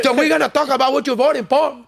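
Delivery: a hint of a lecturing dad; mock dad interrogation. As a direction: lightly voice the bossy father. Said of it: "I'm like".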